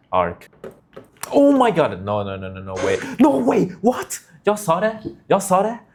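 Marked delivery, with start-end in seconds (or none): 1.3-2.0 sudden high loud gasp of shock; shocked shout. Real, not acted. 3.2-5.8 high, loud shouts of disbelief; excited disbelief. Real, not acted. Excited reaction to game event.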